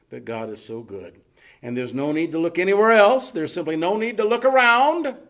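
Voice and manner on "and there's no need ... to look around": rising to a high, loud climax; full-force preaching. Acted. Preacher assuring the congregation there's no need to look elsewhere, climbing forcefully. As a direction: build and ring out the last word.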